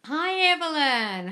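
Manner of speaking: high, slow sing-song hello; excited greeting. Real, not acted. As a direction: call out the name warmly. Greeting viewer joining live.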